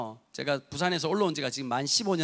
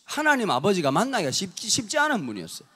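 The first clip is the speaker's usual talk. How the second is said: normal talking voice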